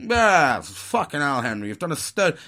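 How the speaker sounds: hammed-up blokey gushing; dickhead mode. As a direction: lay on loud, matey admiration. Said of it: "switch into fucking dickhead mode".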